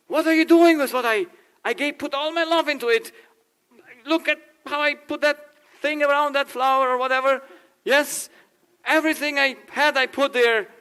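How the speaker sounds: high, loud indignant protest; offended boyfriend. Retold or imitated. Boyfriend protests loudly that his girlfriend threw away his flowers. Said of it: "he says".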